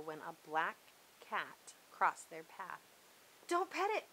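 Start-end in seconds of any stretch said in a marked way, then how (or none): 3.5-4.1 sharp high cry of warning; alarmed cry. A narrator's reading. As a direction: cry out to stop him, louder. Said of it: "cried Wendell".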